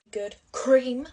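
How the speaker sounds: cream punched loud and high with a rise; stress on cream. Shown as a demonstration. Makeup vlogger to viewers, stressing cream loudly. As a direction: lean hard on the word cream, loud and high. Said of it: "Has to emphasize the word cream".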